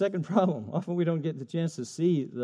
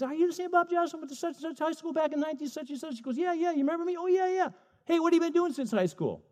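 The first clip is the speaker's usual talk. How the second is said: much higher, bubbly recognition voice; excited nurse; 12 semitones higher, 4 dB quieter, faster than usual. Acted. Nurse Wanda thrilled to meet her old classmate, high and bubbly.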